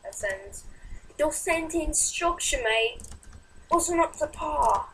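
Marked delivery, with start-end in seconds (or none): 1.2-4.9 slow, higher mock-British voice; British accent. Chiding a gaming friend's sentence structure, mock British accent. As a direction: put on a drawn-out British accent. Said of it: "tell me how, how my accent is".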